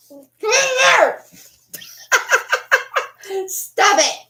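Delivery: high, laughing protest. Real, not acted. Pushing the dog off her face, laughing. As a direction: giggle while fending off the dog.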